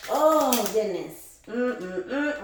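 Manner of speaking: ordinary delivery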